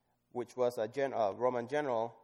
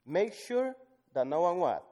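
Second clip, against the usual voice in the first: high, loud question thrown out to the room; call to the crowd; 7 semitones higher, 7 dB louder than usual. Real, not acted. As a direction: challenge them to fill in the word. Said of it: "preacher quizzing the congregation".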